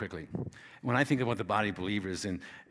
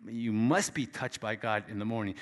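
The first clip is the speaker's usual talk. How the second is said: ordinary delivery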